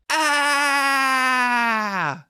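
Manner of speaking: high startled yelp in a comic bit; startled shout. Acted. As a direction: cry out in sudden alarm. Comic character screams.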